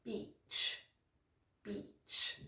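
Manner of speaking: BEACH said very softly with a stretched, smiling EE; long E. Shown as a demonstration. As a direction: stretch the vowel in "beach" through a smile. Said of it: "have a long E sound".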